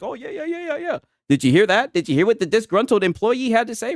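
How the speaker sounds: mock gossip. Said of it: "everybody's like".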